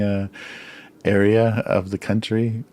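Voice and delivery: usual speaking voice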